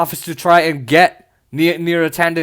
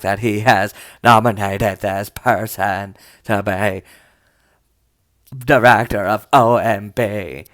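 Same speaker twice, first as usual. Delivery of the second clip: much lower, very slow, fretful senator; Susan Collins impression; 9 semitones lower, much slower than usual. Mocking Susan Collins for viewers, low and very slow fretting. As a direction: stretch out every worried word in a low voice. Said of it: "that's how she talks".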